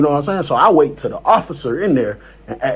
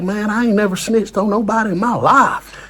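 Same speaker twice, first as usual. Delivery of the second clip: imitated country accent; 5 semitones higher than usual. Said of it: "The accent, bro, that was good".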